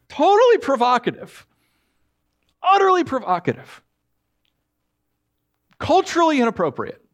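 high-pitched, scandalized emphasis; emphatic shock. Real, not acted. Preacher stressing how improper the scene is, scandalized. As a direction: land each phrase high, with a pause after.

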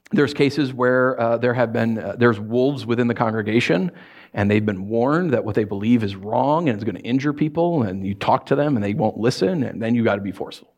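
normal talking voice

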